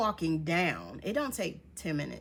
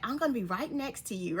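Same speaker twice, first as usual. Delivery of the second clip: put-on higher-pitched little girl, reassuring; 6 semitones higher than usual. Little girl Demi promising to stay close, reassuring. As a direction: lightly voice a sweet child, pitched up. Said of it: "the little girl Demi was like".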